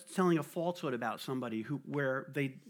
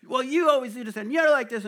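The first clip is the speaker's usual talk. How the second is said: louder, higher, accusing; mock accusing argument; 7 semitones higher, 6 dB louder than usual. Imitating a quarrel while preaching, mock accusation. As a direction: play someone tearing another down. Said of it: "tearing them".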